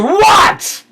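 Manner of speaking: high, loud startled shout; both cooks whip round in surprise; startled what. Acted. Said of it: "they both turn around at you and go".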